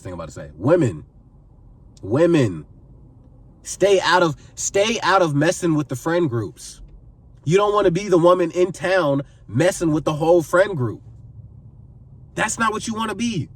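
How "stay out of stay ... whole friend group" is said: ordinary talk, nothing marked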